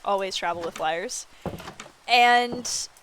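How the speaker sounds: normal talking voice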